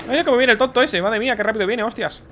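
high, loud startled exclamation; alarmed shout. Real, not acted. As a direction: call out in alarm. Enemy rushing toward the player. (exclamación alarmada y fuerte)

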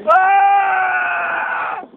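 long loud held yell; long scream. Real, not acted. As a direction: hold the scream through the spin. (grito longo)

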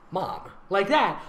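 usual speaking voice

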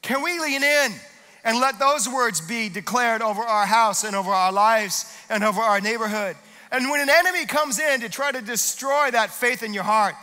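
ordinary talk, nothing marked

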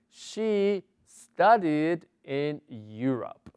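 slow, careful English model sentence; slow model reading. Shown as a demonstration. Teacher reading the example slowly to the class. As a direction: read it out slowly for learners. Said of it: "teacher models English sentence".

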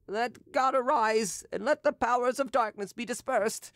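mock-pious preaching; solemn exorcism prayer. A narrator's reading. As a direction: banish the dark powers with fervour. Derues commanding evil spirits.

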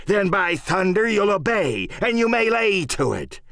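salty sea-dog asserting command; swaggering pirate, pitch leaping on threats; pirate captain Silver, scornful. Acted. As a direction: scorn them, then lay down the law.

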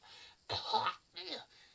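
loud coughing fit on the phone. Pretend. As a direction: hack and splutter. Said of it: "got a bit of a cough".

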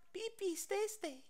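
very high, soft squeak; small falsetto baby talk; tiny companion voice. Acted.

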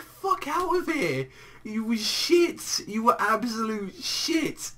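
high, slow, drawn-out contempt; scornful dismissal. Real, not acted. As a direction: send him packing in high-pitched disgust. Scornful rant addressed to Botamo.

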